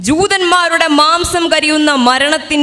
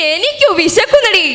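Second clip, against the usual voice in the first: put-on crazed old woman; 8 semitones higher than usual. Hungry old grandmother whining at Sophie. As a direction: whine from hunger.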